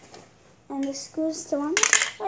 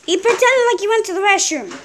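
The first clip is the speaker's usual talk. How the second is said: loud tattling; 4 semitones higher, 11 dB louder than usual. Real, not acted.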